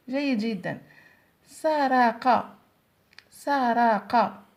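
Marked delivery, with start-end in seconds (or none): 1.5-4.4 very slow, stretched سرق; teacher dictating a word to the class, very slowly; slow dictation. Shown as a demonstration. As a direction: say سرق slowly, holding every sound so children hear the final ق. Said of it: "في آخر الكلمة".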